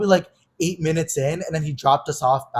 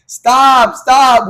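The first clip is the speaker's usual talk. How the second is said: high, loud yells of stop; re-enacted screaming; retold group screaming; 7 semitones higher, 8 dB louder than usual. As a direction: belt out each stop like a desperate passenger. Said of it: "we're all screaming".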